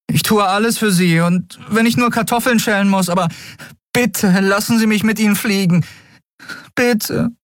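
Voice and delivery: put-on a desperate kid begging; pleading boy. A narrator's reading. The boy begs the captain for a chance, high and pleading. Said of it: "flehte der Junge".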